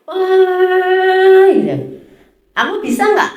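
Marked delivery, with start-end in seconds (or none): 0.1-1.5 mock chatter exclamation. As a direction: toss off an excited Wah like gossiping women.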